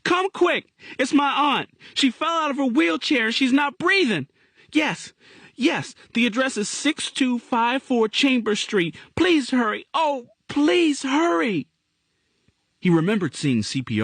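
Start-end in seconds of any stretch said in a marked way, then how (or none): 0.0-9.0 put-on panicked child on an emergency call; screaming into the phone. A narrator's reading. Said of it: "screaming into the telephone". 9.2-9.9 put-on high, louder boy's plea; frantic child begging; urgent plea. A narrator's reading. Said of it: "screaming into the telephone". 9.9-11.7 put-on high, slow pleading boy's voice; slow, despairing child's plea; drawn-out desperate plea. A narrator's reading. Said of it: "screaming into the telephone".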